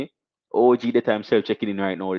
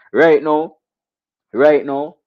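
hype call; 6 semitones higher, 9 dB louder, much slower than usual. Real, not acted.

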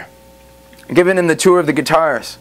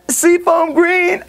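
excited reveal; much slower than usual. Real, not acted. Showing off the guitar's colour.

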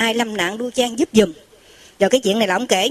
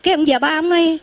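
ordinary talk, nothing marked

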